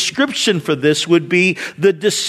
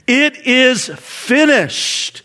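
slow, drawn-out triumphant declaration, higher; triumphant cry; 4 semitones higher, much slower than usual. Retold or imitated. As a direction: proclaim it as a final victory cry. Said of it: "he cried out triumphantly".

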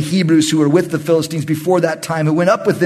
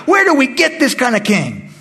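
exasperated priest; 5 semitones higher than usual. Retold or imitated. Priest grumbling about Saul to himself, exasperated. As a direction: throw up your hands at this king.

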